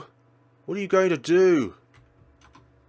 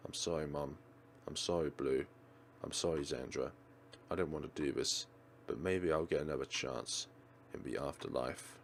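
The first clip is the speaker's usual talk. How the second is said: much lower, softer apology; soft sorrowful farewell; 13 semitones lower, 16 dB quieter than usual. Acted. The heroine apologising to Mum, Blue and Xandra, softly sad. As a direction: say goodbye quietly and sadly.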